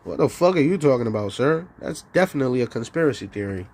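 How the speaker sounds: low, fast brush-off voice; mock dismissive official. Voicing the authorities brushing off the question, low and fast. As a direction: rattle off the denial low and quick.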